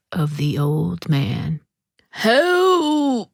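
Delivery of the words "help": high, drawn-out cry for help; high plaintive call. A narrator's reading. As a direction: call out in one long falling note. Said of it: "you call".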